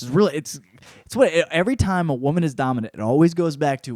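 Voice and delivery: normal talking voice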